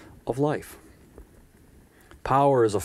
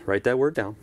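ordinary talk, nothing marked

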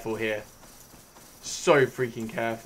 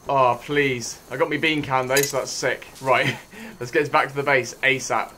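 usual speaking voice

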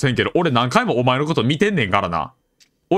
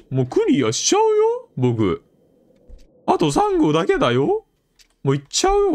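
put-on high childish teasing voice; cutesy taunt; 14 semitones higher than usual. Player taunting the game, high cutesy child voice. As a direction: tease the game like a bratty kid.

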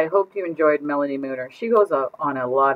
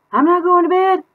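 very high, louder squeaky refusal; shrieking little kid; defiant child; 9 dB louder than usual. Acted.

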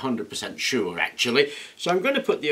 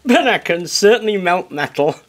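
laughs, then says it louder; loud self-mocking laugh; 6 semitones higher, 8 dB louder than usual. Real, not acted. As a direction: chuckle at your own failure. Jokes after failed weld attempt.

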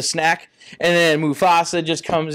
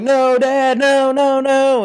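high-pitched pleading wail, hammed up; mock anguished cry; 9 semitones higher, 4 dB louder, slower than usual. Simba as his father gets trampled, mock anguish. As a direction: play a distraught son, voice pushed up high.

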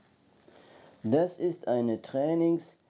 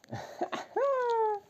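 high giggle; 6 dB quieter than usual. Real, not acted.

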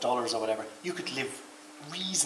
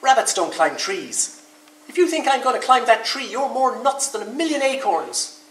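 loud, high indignant outburst; shouting up; 11 semitones higher, 7 dB louder than usual. A narrator's reading. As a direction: yell the refusal up into the branches. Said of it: "shouted Rabbit up the tree".

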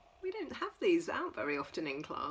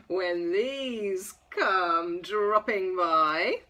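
lively sing-song picture-book reading; reading to children; 5 semitones higher, 4 dB louder, slower than usual. Acted. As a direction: read it bright and playful for toddlers. Reads picture book to toddlers.

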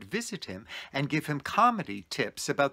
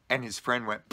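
usual speaking voice

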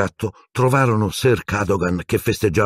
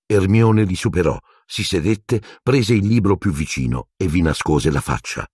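usual speaking voice

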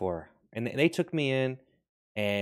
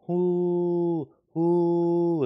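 high hoo calls; 8 semitones higher, 6 dB louder than usual. Acted. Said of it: "And you go".

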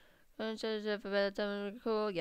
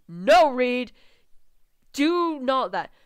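raised, louder cry of objection; loud protest; 5 semitones higher, 10 dB louder, slower than usual. Acted. Onlooker stopping Reed from kissing the man, loud protest. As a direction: call out, then stretch the words slowly.

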